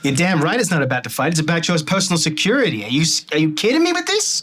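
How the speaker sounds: high, loud rising rant; angry manager. Acted. George yelling at the captain, high and loud. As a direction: blow up at the watch captain.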